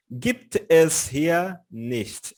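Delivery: very slow German, each word set apart; slow careful pronunciation. Shown as a demonstration. Teacher repeating the phrase for the class, very slow. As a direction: read it slowly for learners. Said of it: "Pode pronunciar pausadamente".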